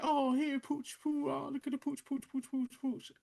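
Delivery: put-on high, soft baby talk to a dog; soft high-pitched mocking coo; mock pet cooing. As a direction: imitate someone cooing at a little dog. Imitates man cooing at stolen dog.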